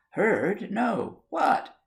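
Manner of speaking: each word climbing in alarm; startled. Acted.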